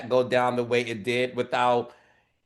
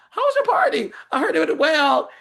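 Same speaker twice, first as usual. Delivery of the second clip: very high, sugary fake politeness; mock sweet; 14 semitones higher, 5 dB louder, faster than usual. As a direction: ask sweetly with a knife behind it. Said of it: "Kyle got some balls to ask".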